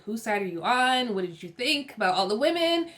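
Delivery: ordinary talk, nothing marked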